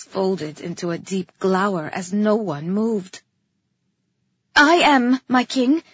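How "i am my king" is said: loud call forward. A narrator's reading. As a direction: call out boldly. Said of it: "I called".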